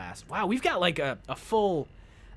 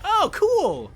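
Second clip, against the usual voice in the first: high, loud mock delight; mock excited exclamation; imitated gullible excitement; 9 dB louder than usual. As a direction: imitate your own thrilled reaction, high and loud. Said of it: "And I'd be like".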